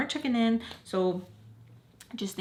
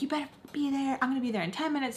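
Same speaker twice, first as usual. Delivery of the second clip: high, stern mom imitation; mad mom; 7 semitones higher, faster than usual. Mom ordering kid to be there, high angry mimicry. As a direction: warn in a pitched-up angry mom voice. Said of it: "So she's mad at me".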